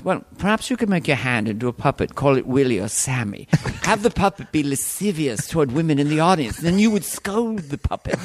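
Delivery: put-on English court accent, rising in pitch; medieval Englishman. Courtier inventing puppet act for king, English accent. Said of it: "in thirteen hundred in England".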